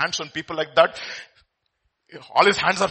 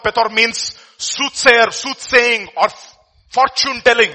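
slow, loud spelled-out definition; slow emphatic; 7 semitones higher, 10 dB louder, slower than usual. Real, not acted. Preacher defining Pethor for the church, slow and loud. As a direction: lay each word down slowly.

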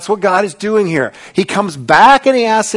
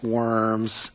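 ordinary delivery

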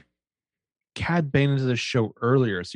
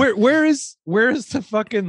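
excited; 9 semitones higher, 3 dB louder than usual. Real, not acted. Excited recall of a meme.

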